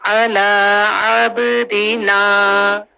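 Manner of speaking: अला अब्दिना slow with long vowels held; slow recitation; tajweed recitation. Shown as a demonstration. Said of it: "तीनों को दो दो हरकत का टाइम".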